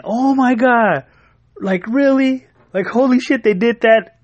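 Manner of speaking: high mock gasp of a wife in disbelief; imitating wife. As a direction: play the shocked, giggly wife reading. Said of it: "You could just hear her laughing".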